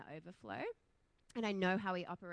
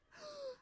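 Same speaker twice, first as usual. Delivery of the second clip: shocked gasp; 5 dB quieter than usual. Retold or imitated. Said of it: "And I'm like".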